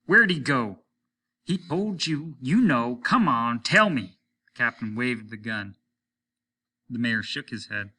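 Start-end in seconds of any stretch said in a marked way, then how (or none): 0.1-4.2 loud, high furious questioning; enraged loud demand; angry demand. A narrator's reading. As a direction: threaten him at gunpoint. Captain demands at gunpoint.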